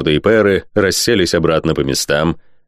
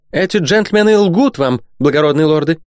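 very high, sharp objection; loud, sharp interruption; 10 semitones higher than usual. A narrator's reading. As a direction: cut in sharply, voice pitched far up. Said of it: "громко и резко перебила". (громко и резко, перебивая)

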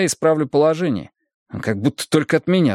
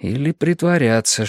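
usual speaking voice

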